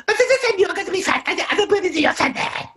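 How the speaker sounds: very high cartoonish squeak; silly squeaky voice. Shown as a demonstration. Hypnotist mocking a hurtful father in a squeaky voice. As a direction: make the father's words sound ridiculous, high and squeaky. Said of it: "and one more time, him go".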